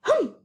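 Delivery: a high, loud scornful hum; huffy hum. A narrator's reading. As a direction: scoff with a sharp hum. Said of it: "hummed the voice".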